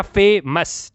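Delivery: Famous slowly, as फे, then मस; split into syllables. Shown as a demonstration. Teacher introducing a two-syllable example, famous split in two. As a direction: break famous after फे. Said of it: "फे फिर मस".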